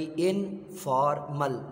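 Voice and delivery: "इनफॉर्मल" slowly, as इन, फॉर, मल; "इनफॉर्मल" with a pause after each syllable; split into syllables. Shown as a demonstration. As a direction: break "इनफॉर्मल" into three separate syllables. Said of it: "अलग अलग ... तोड़ के बता दिए हैं".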